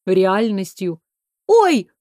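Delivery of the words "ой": sharp high startled cry; surprised exclamation. A narrator's reading. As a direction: yelp it in surprise. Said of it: "воскликнула Майра".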